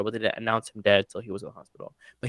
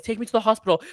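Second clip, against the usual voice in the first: high, panicked plea; bleeding finger, pleading high with mom; 9 semitones higher, faster than usual. Retold or imitated. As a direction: beg mom for the hospital. Bleeding daughter begs mom.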